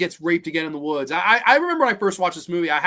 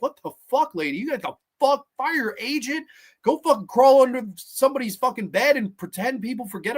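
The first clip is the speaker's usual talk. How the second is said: mock outrage; 8 semitones higher than usual. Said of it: "I remember being like".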